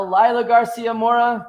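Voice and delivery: big announcer-style name call. Real, not acted. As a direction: call her name out loud and proud. Introducing returning co-host.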